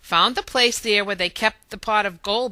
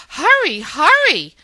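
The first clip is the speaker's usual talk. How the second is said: much higher, louder urgent command; urgent order; 9 semitones higher, 9 dB louder than usual. A narrator's reading. Ari ordering the others to race for the rainbow. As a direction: hurry them along, sharp. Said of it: "Ari ordered".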